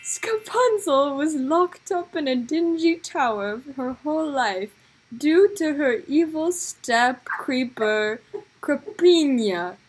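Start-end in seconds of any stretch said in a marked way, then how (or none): none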